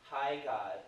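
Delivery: ordinary talk, nothing marked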